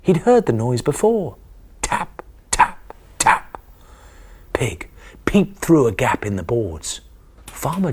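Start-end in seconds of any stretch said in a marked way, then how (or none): 1.8-3.6 unvoiced tap tap tap; whispered tapping. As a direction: whisper the taps like a noise. Noise from the barn.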